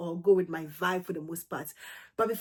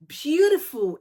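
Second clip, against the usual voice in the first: gushing; 8 semitones higher, 9 dB louder, slower than usual. Real, not acted. Gushing over a perfume.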